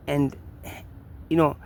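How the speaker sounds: usual speaking voice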